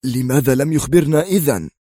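sudden sharp question; بريماكوف. A narrator's reading. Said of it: "قال بريماكوف في حدة مباغتة". (بحدة مباغتة)

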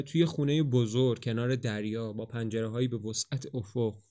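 usual speaking voice

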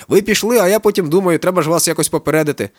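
high, loud happy chatter; joyful. A narrator's reading. Kakao eagerly warning his friends, high joyful chatter. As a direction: rattle on eagerly and happily. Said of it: "продовжує радісно Какао".

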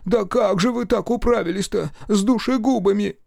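high, fussy lamenting servant; wailing old servant. A narrator's reading. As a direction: fuss over the master anxiously. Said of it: "причитал Захар".

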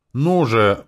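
usual speaking voice

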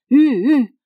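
high, loud call to a horse; shouted horse-urging cry; ploughman's distant cry. A narrator's reading. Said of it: "J'entendis encore dans le lointain".

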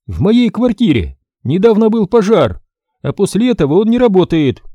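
раздражённый возглас высоко; ударение на «мне», высоко и громко; раздражённо. Acted. Галина продолжает. (Галина Николаевна раздражённо отвечает мастеру)

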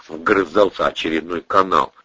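ordinary delivery

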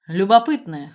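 loud, amused scoff; mildly mocking, voiced with a punch; snorting. A narrator's reading. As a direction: throw it out loudly with a snort. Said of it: "хмыкнул он".

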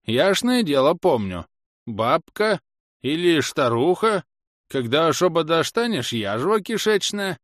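put-on high, slow lisping old man; cranky old villager. Old man naming his wife with insults, cranky lisp. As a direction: grumble in a high, lisping rustic voice.

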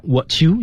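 "what you" merged as "wha-chu"; linked speech. Shown as a demonstration. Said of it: "连读".

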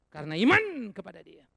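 loud, peaking in the middle; loud declaration. Real, not acted. Preacher driving the point home, loud declaration. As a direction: hammer it home.

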